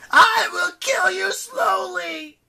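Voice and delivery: loud, high, slow monster screech; furious, drawn-out villain howl; growling goddess of misery. A narrator's reading. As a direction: shriek the threat, dragging out each word. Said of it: "she growled".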